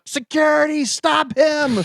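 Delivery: high shriek calling security; mock high shriek for help; panicked woman. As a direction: shriek for the guards in a lightly hammed-up panic. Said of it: "woman freaks out".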